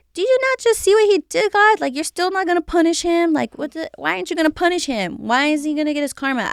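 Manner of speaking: high, fast, frustrated outburst; angry at God. Retold or imitated. Venting to God at her ex's table, high and fast with anger. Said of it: "so I'm just mad at him".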